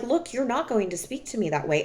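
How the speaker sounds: soft, firm, quick; quiet firm boundary; calm, not screaming. Retold or imitated. Telling him off without raising her voice, calm. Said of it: "and I'm not screaming".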